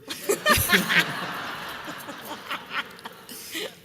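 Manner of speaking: very high airy laugh rising; high breathy laugh. Real, not acted. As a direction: crack up at your punchline. Laughs at wine punchline.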